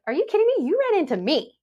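high, disbelieving "are you kidding me"; incredulous thought. Retold or imitated. Rear-ended driver thinking at the other driver, high disbelief. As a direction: pitch it up in outraged disbelief. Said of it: "I'm sitting there like".

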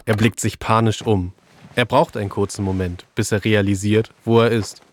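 normal talking voice

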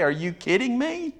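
high, rising disbelieving question; incredulous. Acted. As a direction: throw it back in disbelief. Incredulous retort to believers.

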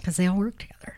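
whisper. Real, not acted. Aside in true crime talk.

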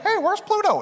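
very high cartoon mouse voice; high-pitched cartoon impression; Mickey Mouse. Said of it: "I've heard Mickey saying".

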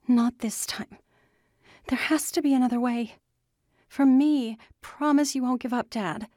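high, urgent pleading; strained plea. A narrator's reading. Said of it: "Andy's voice strained".

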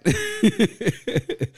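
high, loud burst of real laughter; loud high-pitched laugh. Real, not acted. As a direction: crack up at the absurd line. Laughing at an absurd lyric.

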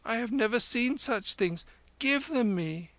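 put-on high-pitched child voice; small child demanding. Child wanting the lama's beads, high childish demand. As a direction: pipe up like a wanting toddler.

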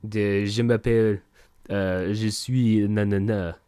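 bluffing through mock French; French accent. Pretend chef introducing himself in French, hammy French accent. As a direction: fumble some fake French in accent. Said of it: "French would say".

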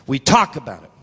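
low voice with a punched TALK, falling away; low emphatic repeat. Real, not acted. Preacher repeating it to congregation, low and weighty. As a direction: drop it low and let it land.

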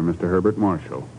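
ordinary delivery

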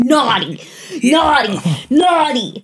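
put-on a demon beating a child, chanting naughty; goat demon scolding. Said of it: "hitting you with a branch, saying".

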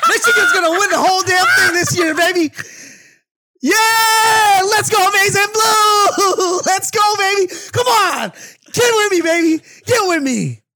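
screaming with wild excitement; full-on fan hype, yelling; hype shout. Real, not acted. Hyped prediction, shouting for Michigan.

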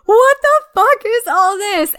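loud, slow, high disbelief; shocked outburst. Retold or imitated. To her co-host, a loud shocked what the fuck. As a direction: blurt it out, stunned by the mess.

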